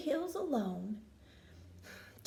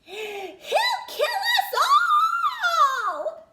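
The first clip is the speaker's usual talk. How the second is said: panicked squeal leaping much higher and louder; squeaked; 8 dB louder than usual. A narrator's reading. Kangaroo rat panicking at the dancing snake, high squeal. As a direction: squeak in terror. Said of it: "squeaked a kangaroo rat".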